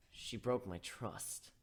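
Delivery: hurt mutter. Acted. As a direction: barely get it out. Hurt hero answering quietly.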